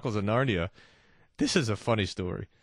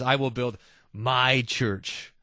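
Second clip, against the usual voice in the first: "my" stressed, said slowly; slow and firm, stressing "my"; slow emphatic quote; much slower than usual. Shown as a demonstration. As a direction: quote Jesus slowly, weighting "my". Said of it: "the word my".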